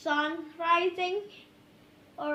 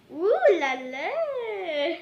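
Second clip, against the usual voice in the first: playful; 4 semitones higher, 7 dB louder, much slower than usual. Real, not acted. Drawn-out playful exclamation.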